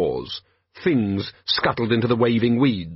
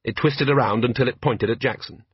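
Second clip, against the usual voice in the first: ordinary delivery, nothing marked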